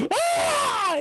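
high startled yelp; startled shriek. Acted. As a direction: shriek as if caught. Mock scream at being seen.

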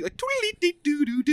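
high tootling fife noise; imitated fife tune. Describing the war movie scene, a high mock fife tootle. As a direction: toot it like a little flute.

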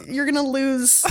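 normal talking voice

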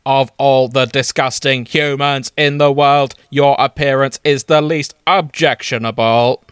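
monotone robotic Dalek greeting. Acted. Dalek best friend greets Amelia at the door, mechanical monotone. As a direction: greet her as a stiff Dalek. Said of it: "his tender, screaming voice".

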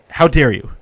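loud retold indignant outburst; about to scream. Driver fuming at truck blocking his spot, loud indignation. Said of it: "I was about to scream".

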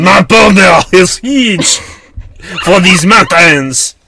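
loud, slow, high French proclamation; hammed-up Frenchman declaring; French accent. Mock French explorer shouting.

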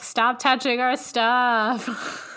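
slow, high mock scolding; scolding Egyptians. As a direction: scold them slowly, like a fed-up local. Egyptians voiced telling off looters.

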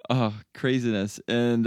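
ordinary delivery

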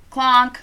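mimicked clink. Glasses clinking, sound mimicked.

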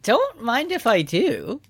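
slow high mock voice. Jokey reply to sword.

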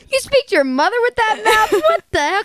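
high-pitched imitation of an indignant dad; lightly voiced dad telling off a teenager; scolding dad. Friend's dad scolding, voiced in story.

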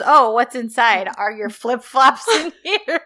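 amused retelling, giggling at the end; laughing quote. Retold or imitated. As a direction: crack up as you quote it. Laughs while quoting a silly line.

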